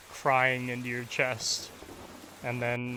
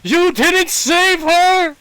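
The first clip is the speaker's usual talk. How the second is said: screamed, much higher and louder, slow; yelling; 15 dB louder, slower than usual. Acted. Father grabbing Asante's tunic, yelling in his face. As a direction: yell it right into his face. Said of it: "and yells in your face".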